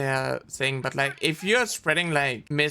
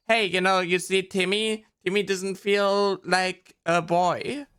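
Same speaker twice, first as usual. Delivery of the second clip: put-on slightly higher, patient grown-up-to-child voice; explaining to a child; 4 semitones higher than usual. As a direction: spell it out simply for a little kid. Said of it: "so easy to explain that to children".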